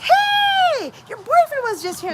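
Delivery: high, loud call across the hall; loud excited shout to the girls; retold shout. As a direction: yell it out to warn them. Calling out to the girls.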